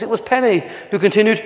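plain reading voice

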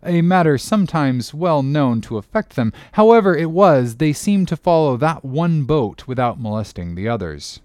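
plain reading voice

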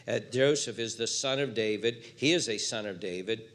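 usual speaking voice

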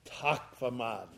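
low, soft murmur of thanks; understated, softly spoken thank you; hushed thanks. Retold or imitated. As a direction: say it quietly and low, as a careful reply. Acting his thanks after the meal.

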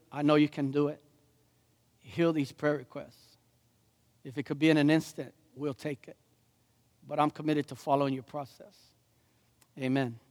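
soft, low prayer voice. Real, not acted. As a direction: pray quietly and unhurriedly, with pauses. Leads a prayer.

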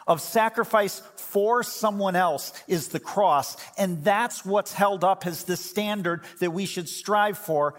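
rising fervent sermon; full-force preaching. Acted. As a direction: build it up like a pulpit climax.

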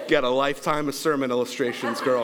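usual speaking voice

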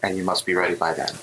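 a hint of an eerie voice in the mind; echoing female voice. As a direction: speak like a distant voice echoing in the head. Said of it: "female voice kind of echo in your mind".

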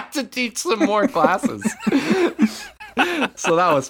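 breaking into high laughter mid-sentence; laughing. Real, not acted. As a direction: tell it while cracking up laughing. Laughing at own story.

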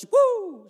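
high whooping shout of joy; happy holy whoop; joyful woo. Retold or imitated. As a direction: let out a blessed woo. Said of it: "get so blessed".

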